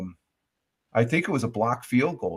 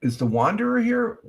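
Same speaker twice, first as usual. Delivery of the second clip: asking the chat up high, curious; high searching call; 8 semitones higher than usual. Real, not acted. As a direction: call out for the missing viewer in a raised pitch. Looking for a viewer in chat.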